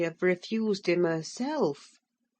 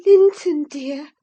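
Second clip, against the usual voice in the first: plain reading voice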